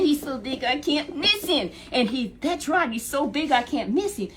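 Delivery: high, loud confident boast; proud and sure, sell the line. Retold or imitated. David boasts he cannot miss the giant, loud and high.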